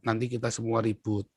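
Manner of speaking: lightly voiced father, softly spoken; quiet word of caution to a son; soft, subdued fatherly warning; father's counsel. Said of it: "ayah saya selalu bilang apa".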